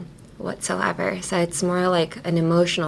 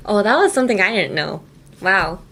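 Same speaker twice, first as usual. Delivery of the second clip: ordinary talk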